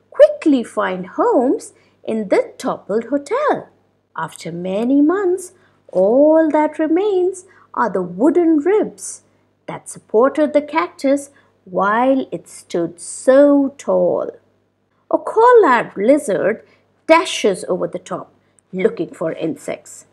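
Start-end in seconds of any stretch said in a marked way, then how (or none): none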